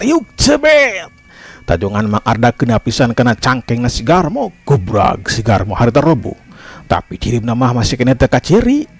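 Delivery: ordinary delivery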